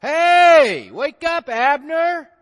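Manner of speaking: high, loud yell from a hilltop; big calling-out shout. Acted. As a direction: holler to wake someone far below. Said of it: "he's yelling down".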